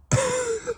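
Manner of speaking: quick incredulous laugh; a single scoffing laugh; laughing in disbelief at the inactive group; short laugh. Real, not acted. Laughs at the dead Discord.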